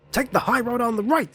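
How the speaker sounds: loud, high cartoon thug shout; overacted anime dub. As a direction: yell directions like a hammy villain. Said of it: "said the heavy-set fella".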